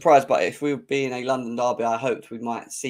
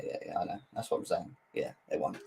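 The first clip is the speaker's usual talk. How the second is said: unvoiced hushed asides; whispered aside; 5 semitones lower, 12 dB quieter than usual. Real, not acted. Quick aside to co-host, whispered. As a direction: whisper it off to the side.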